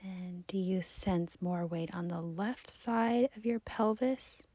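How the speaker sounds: soft, unhurried meditation voice with long pauses; guided body scan. Acted. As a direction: gently guide the listener's attention through the body. Leads a seated body scan.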